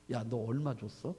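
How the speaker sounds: low, hushed retold question; discreet asking. Curious worker asking another about the pay, low and discreet. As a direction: ask it low and soft, as if on the quiet.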